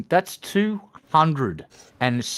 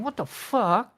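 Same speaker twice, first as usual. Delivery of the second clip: stunned; 10 semitones higher, slower than usual. Retold or imitated. Voicing stunned onlookers.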